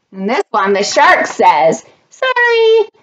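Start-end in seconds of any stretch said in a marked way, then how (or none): 2.1-2.9 high, drawn-out sorry; sing-song apology pitched way up; shark's apology. A narrator's reading. Shark apologises at the piano recital, high voice.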